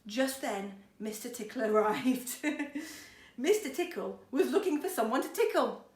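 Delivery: ordinary delivery, nothing marked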